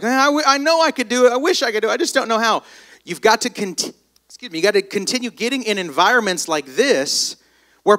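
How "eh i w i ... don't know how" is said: high, quick whiny wishing; hammed-up moan of can't-do longing; mock whining. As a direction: imitate a helpless whiner. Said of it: "instead of just looking out the window and being like".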